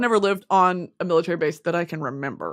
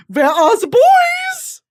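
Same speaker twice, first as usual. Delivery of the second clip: hammed-up damsel looking for the boys; mock German girl; 7 dB louder than usual. Asking guards for young servicemen, high hammed-up girly call. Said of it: "And I'm gonna say".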